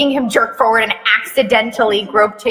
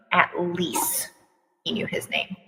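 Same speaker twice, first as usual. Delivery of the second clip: whispered repeat, much softer; under breath; 4 semitones lower, 12 dB quieter than usual. Real, not acted. Reader repeats the line to herself in a whisper. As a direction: mutter it to yourself.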